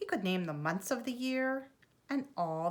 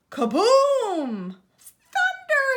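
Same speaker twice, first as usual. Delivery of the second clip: very high, very loud panicked scream; terrified scream; 12 dB louder, slower than usual. A narrator's reading. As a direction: scream at the thunder crash. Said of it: "he screamed".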